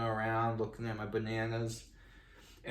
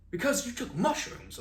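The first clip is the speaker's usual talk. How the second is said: very high-pitched mock explanation; exasperated friend; 13 semitones higher than usual. Friend reminds him he took mushrooms, exasperated. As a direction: imitate a friend stating the obvious, pitched way up.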